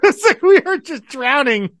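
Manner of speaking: high-pitched, laughing through the words; amused, laughter-filled exclamation. Real, not acted. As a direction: say it while cracking up. Laughing at pile of Britishisms.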